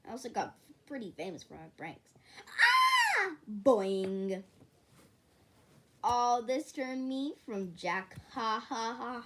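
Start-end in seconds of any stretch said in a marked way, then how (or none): 2.5-3.3 high scream. Acted. Prank scream.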